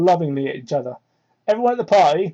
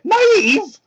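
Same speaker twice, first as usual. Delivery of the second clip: high, squeaky mock repeat; mocking squeak; 13 semitones higher, 4 dB louder than usual. Real, not acted. Mocking the fan fiction's wording, high squeak. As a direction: squeal the word in mockery.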